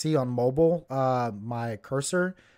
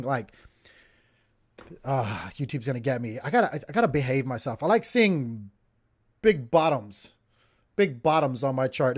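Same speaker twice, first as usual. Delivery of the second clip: ordinary delivery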